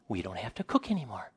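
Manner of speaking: softly acted dawning realisation; hushed mock amazement; quiet realisation. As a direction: say it small, as it just sinks in.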